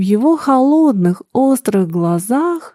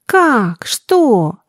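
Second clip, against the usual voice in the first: bewildered; 3 semitones higher than usual. Acted. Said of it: "растерянно спросил он".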